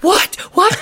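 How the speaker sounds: high-pitched alarmed question, acted out; mock panicked parent on the phone; freaking-out dad. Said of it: "he's freaking out".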